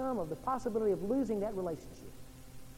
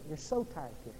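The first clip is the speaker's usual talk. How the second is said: normal talking voice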